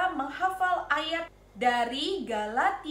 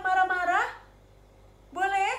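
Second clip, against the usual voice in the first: ordinary delivery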